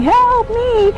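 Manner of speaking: very high squeaky cry; comic squeaky plea for help; high fly voice. Retold or imitated. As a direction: imitate the famous tiny fly scene. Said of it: "it's going".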